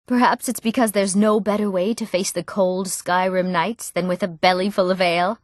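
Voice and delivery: normal talking voice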